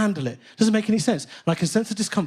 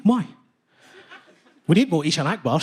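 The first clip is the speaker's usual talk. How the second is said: usual speaking voice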